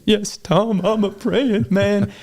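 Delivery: high, slow comic whimper; high-pitched pretend near-sob; mock tearful reply. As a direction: answer like a hammed-up, overwhelmed crybaby. Acted tearful reply to the cowboy.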